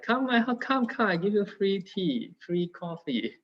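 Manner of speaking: imitating grandmother. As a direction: coax her friends in like a sweet auntie. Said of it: "they come, like".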